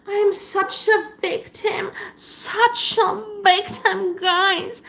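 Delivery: mock crying victim. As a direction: whimper it like a self-pitying influencer. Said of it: "And she's doing this".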